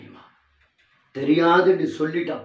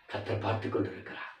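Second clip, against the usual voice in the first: whisper; 9 dB quieter than usual. Acted. Sudden hush after loud build.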